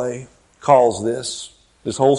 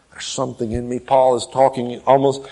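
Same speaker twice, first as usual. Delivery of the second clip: normal talking voice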